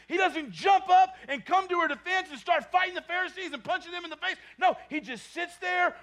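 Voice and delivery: loud, high, fired-up sermon delivery; pulpit-pounding preacher at full volume; full-force preaching. Acted. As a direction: preach it out to the whole church.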